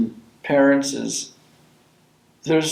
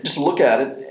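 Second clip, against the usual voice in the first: ordinary talk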